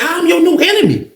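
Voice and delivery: retold protest. Protests being called the new enemy.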